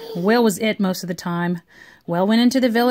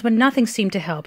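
plain reading voice

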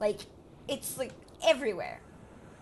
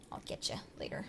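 whispered; 10 dB quieter than usual. Real, not acted. Muttered threat at creature.

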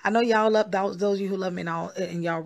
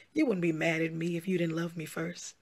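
ordinary delivery

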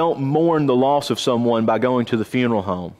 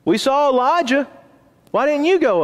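mocking taunt; 10 semitones higher than usual. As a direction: taunt him like mocking lads, voice pitched up. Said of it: "So they're saying".

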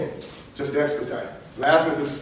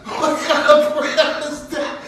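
high, loud, slow mock incredulity; laughing disbelief; 13 semitones higher, 7 dB louder, slower than usual. Teasing the aunt about her grace, laughing incredulity. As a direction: protest through laughter, pitched way up. Retelling baffled reaction to aunt's grace.

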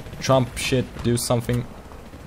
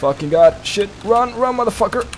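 high, loud, frantic yells; panicked yelling; 13 semitones higher, 4 dB louder than usual. Real, not acted. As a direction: yell in a panic while fleeing. Panicked escape in a firefight.

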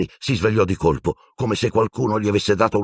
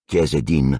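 ordinary delivery

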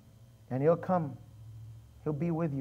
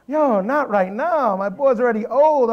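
loud, high mock protest; exaggerated alarmed storytelling; retold panic; 6 semitones higher, 8 dB louder than usual. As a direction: object in comic dismay. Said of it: "I was scared".

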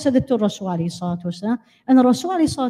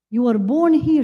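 ordinary talk, nothing marked

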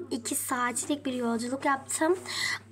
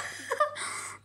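breathy giggle; 11 semitones higher, 6 dB quieter than usual. Real, not acted. Breathy laugh after squeal.

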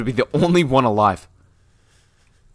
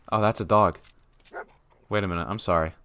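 ordinary delivery

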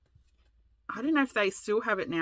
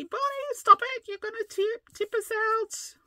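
squeaky high imitation of husband yelling; mock yell. Imitating husband yelling from the canoe, high mock shriek. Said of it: "yelling at me".

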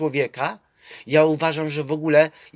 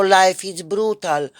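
slow, louder ironic echo of the catchphrase; drawn-out mocking repeat; sarcastic echo; 4 semitones higher, 4 dB louder, slower than usual. Real, not acted. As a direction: throw the phrase back with scorn. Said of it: "mocking the phrase himself".

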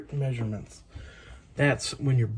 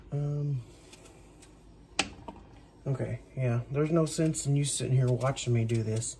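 usual speaking voice

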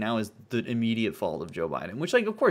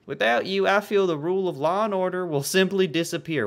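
higher, louder mock southern voice; put-on southern accent, pitched up; bad southern accent; 7 semitones higher, 5 dB louder than usual. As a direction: declare it like a hammed-up Southern fan. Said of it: "My southern accent is just, uh, fucking Dale Gribble".

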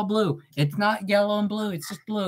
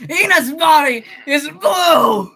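slow, high, loud crying wail; anguished wail; 8 semitones higher, 10 dB louder, slower than usual. Real, not acted. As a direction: wail it in despair. Cries out the claim.

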